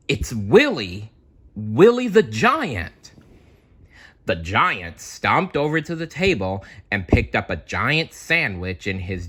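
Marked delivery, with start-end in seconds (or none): 0.1-3.1 alarmed cry. Acted. Characters alarmed by giant.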